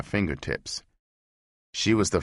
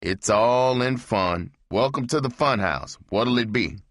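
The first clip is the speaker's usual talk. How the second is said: Frankenstein bartender; 6 semitones higher than usual. Acted. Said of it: "He said".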